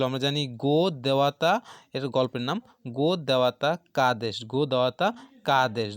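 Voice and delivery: normal talking voice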